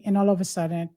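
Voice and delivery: ordinary talk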